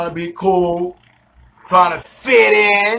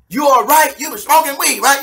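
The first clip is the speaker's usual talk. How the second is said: higher-pitched imitation of mother-in-law; questioning mother-in-law; 6 semitones higher than usual. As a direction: quiz him in a raised, higher voice. Mother-in-law questions him, acted.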